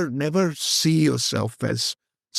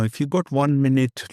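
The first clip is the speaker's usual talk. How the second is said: usual speaking voice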